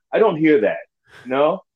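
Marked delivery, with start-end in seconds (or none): none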